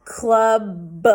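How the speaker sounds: club said loud and clearly; teacher's model word. Shown as a demonstration. Teacher modelling word for kids, loud and clear. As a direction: say it big for the class. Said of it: "What about this one?".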